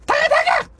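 very high creature chirp; squeaky imp. Pretend. Player voicing the summoned imp, squeaky creature voice. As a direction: squeal the name like a little imp.

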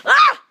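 very high, loud scream of pain; a sharp cry of hurt. Acted. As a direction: shriek as something hits you. Hit in the eye.

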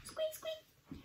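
tiny, very high mouse squeak; high, soft animal voice. A narrator's reading. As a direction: squeak softly like a little mouse. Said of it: "She seemed to be yelling".

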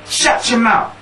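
put-on high, punchy child's comeback; girl's retort. Girl practising her answer to a bully, punchy retort. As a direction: imitate a girl snapping back. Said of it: "And she went".

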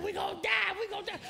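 high-pitched imitation of a panicked scream. Prayer leader clutching a young man's arm, screaming. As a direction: squeal it like a terrified passenger. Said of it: "and she's screaming".

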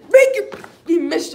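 sudden high, loud burst; frustrated yell. Real, not acted. Fan to a player missing free throws, frustrated yell. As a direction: yell at the shooter in real frustration.

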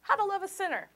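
normal talking voice

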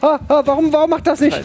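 high frantic panic; rising alarm, voice jumping up; panicked. Real, not acted. Panicking in game firefight.

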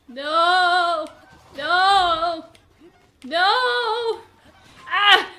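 loud, rising cries of no; loud dismay. Real, not acted. Player watching monsters attack, loud cries of dismay. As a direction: cry out helplessly.

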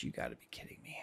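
exasperated whisper under the breath; unbelieving whisper. Real, not acted. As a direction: mutter it in disbelief.

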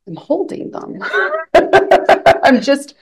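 high, incredulous self-quote breaking into a laugh; amused, high-voiced bewilderment; retold taken aback. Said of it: "I was really taken aback".

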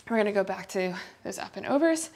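normal talking voice

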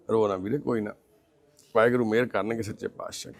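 low, soft reassurance; gentle consoling. Real, not acted. Comforting a crying blind man, gently consoling. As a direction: soothe him quietly, voice dropped.

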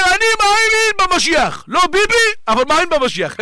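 playful mock declaration ending near a laugh; joking. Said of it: "בצחוק אני אומר".

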